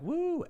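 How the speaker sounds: high, sustained "woo" at ordinary volume; mock catcalling "woo" held on a high note; imitated woo. Guys looking at women, mock admiring woo. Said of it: "guys who look at women and are like".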